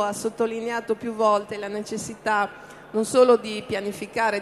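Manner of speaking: normal talking voice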